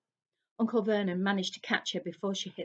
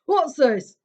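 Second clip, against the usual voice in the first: louder angry demand; growling uncle; 6 semitones higher, 13 dB louder than usual. A narrator's reading. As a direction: snap at the boy in anger. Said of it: "growled Uncle Vernon".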